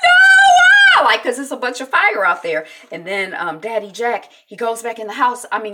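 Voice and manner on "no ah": imitated screaming. Katie seeing fire at her door, mock screaming. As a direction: act out a terrified girl's scream. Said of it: "Katie is screaming".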